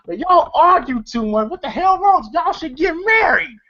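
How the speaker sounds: high-pitched acted scolding; mock exasperation. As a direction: imitate being fed up with bickerers. Acted scolding of bickering pair.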